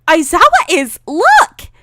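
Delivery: high, loud tattletale cry; excited shout. A narrator's reading. Student outing her married teacher to classmates, excited shout.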